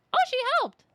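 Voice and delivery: high, loud gushing thanks; squealing thanks. Real, not acted. Streamer thanking the helpful girl, loud gushing. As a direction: gush your thanks over and over.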